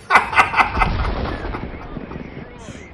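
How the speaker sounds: long, unvoiced cackle; mocking laugh. Commentator mocks the enemy tank, long cackle. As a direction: cackle at their failure.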